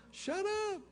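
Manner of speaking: put-on high, soft kid's amazed retort; high childish disbelief; little boy voice. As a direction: say it like a stunned small boy. Son replies in disbelief.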